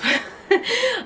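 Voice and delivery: bright high burst of laughter. Real, not acted. Vlogger laughing at her own rude joke to the camera.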